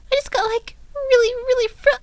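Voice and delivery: high, loud pleading sorry; tearful apology. A narrator's reading. Pinkie apologizing to Fluttershy, high loud and tearful. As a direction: beg forgiveness near tears. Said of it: "starting to sob".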